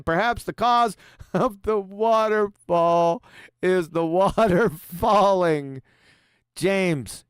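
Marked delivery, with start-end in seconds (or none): 0.0-5.8 slow reading broken by laughs; can't keep a straight face while reading; slowed, chuckling read-aloud; laughing through it. Real, not acted. Reading a silly super chat aloud.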